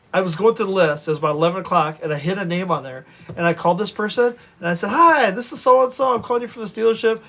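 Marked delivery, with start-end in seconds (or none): 4.9-7.2 upbeat, higher-pitched phone hello; chirpy call greeting. Retold or imitated. Salesman calling from the dealership, chirpy greeting. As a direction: greet a customer brightly on a cold call.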